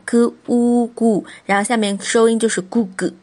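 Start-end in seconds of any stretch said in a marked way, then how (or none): 0.1-1.2 그 우 구 as separate sounds, then blended; sounding out. Shown as a demonstration. Teacher blending consonant and vowel for learners, 그 우 구 sounded out. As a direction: spell out 그, 우, then join them into 구. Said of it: "会拼的".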